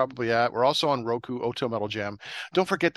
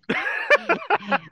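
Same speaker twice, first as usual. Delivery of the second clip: shrieking laugh; squealing high laugh; high squeal of laughter; 5 dB louder than usual. Real, not acted. Cracks up.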